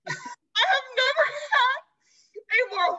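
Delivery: strained high-pitched repeat; overcome squeal. Real, not acted. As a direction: squeal it out, still recovering from laughter. Repeat after laughing fit.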